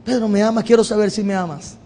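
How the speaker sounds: soft, quiet, gentle asking; Jesus softly asking Peter if he loves him, tender. Pretend. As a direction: ask him tenderly, almost hushed. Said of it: "Jesús con amor y paciencia".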